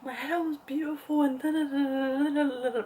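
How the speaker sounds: high-pitched mock crying whine; imitating tearful complaint. Crying to mum over lost hair, high mock-tearful whine. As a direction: whine it like an exaggerated sob story. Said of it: "I start crying and I was like".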